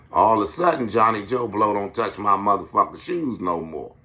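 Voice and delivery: usual speaking voice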